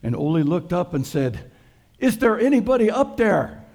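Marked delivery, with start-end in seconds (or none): 2.0-3.6 high, loud, pleading call up to the sky; a stranded hiker yelling up for help; desperate call upward. Acted. Hiker dangling from a tree over a fjord, calling up in a high, loud plea. Man hanging off a cliff calls up.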